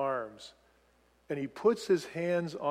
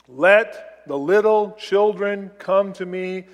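loud, slow, word-by-word command; firm staccato delivery, each word set apart; 2 semitones higher, 7 dB louder, slower than usual. Shown as a demonstration. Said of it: "it's very staccato".

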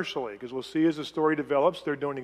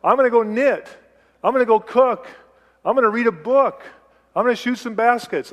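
eager plans; 6 semitones higher, 7 dB louder than usual. Retold or imitated. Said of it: "some of you say".

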